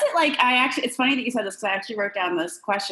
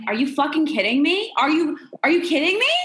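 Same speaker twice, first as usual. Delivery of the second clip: higher, louder South Philly attitude; mock trashy Philly girl outburst; 7 semitones higher, 3 dB louder than usual. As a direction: give it the Philly girl snap, full indignant. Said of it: "that trashy girl from Philly who's like".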